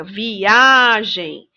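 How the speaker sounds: "Viagem" slow, with a long stressed A; teacher's drawn-out vi-AAA-gem; stressed syllable. Shown as a demonstration. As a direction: lean hard on the A in "Viagem". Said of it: "Olha quem se destaca".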